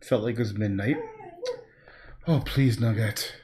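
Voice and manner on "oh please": ordinary talk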